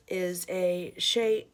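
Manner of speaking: slowly, word by word; slow and careful. Real, not acted. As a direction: take your time over the tricky words. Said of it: "I have to take my time saying that".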